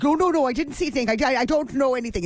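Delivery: fast, high, flustered babble; backing out in a hurry; mother-in-law fleeing the room, babbling that she saw nothing; flustered rushed denial. Retold or imitated. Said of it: "And she's like".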